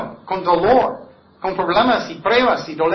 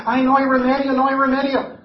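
higher, louder mock lament; despairing sigh; 7 semitones higher, 4 dB louder than usual. Preacher voices a hopeless listener, mock despairing. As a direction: wail hopelessly, lightly hammed. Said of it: "Personas pueden estar escuchando".

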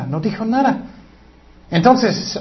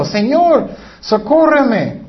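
ruego agudo y lento; súplica; 8 semitones higher, 4 dB louder, much slower than usual. Retold or imitated. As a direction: implora postrada, alargando. Mujer postrada suplicando. (súplica lenta y aguda)

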